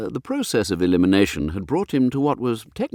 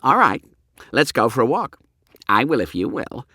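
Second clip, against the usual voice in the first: voiced boy; 5 semitones higher, 3 dB louder than usual. Boy challenges stepfather.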